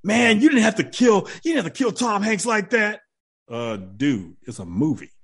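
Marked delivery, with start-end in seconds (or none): 0.0-3.0 high-pitched mock outrage; higher, protesting whine at an actor; mock indignant fan. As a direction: complain like an aggrieved moviegoer. Moviegoer scolds actor for a role.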